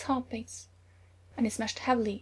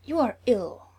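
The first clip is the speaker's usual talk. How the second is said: sharp; 7 dB louder, slower than usual. A narrator's reading. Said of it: "she said sharply".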